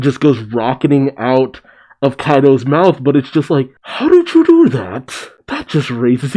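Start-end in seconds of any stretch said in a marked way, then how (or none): 3.8-5.3 high-pitched, baffled outburst; incredulous question. Acted. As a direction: ask it in high-pitched disbelief. Reacting in disbelief to manga scene.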